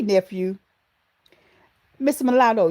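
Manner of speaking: usual speaking voice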